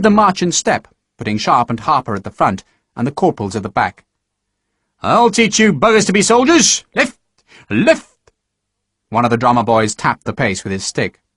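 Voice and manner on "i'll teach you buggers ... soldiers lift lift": high, loud cadence calls; drill sergeant. Acted. Sergeant drilling recruits in step, high loud calls. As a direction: drive the step with it.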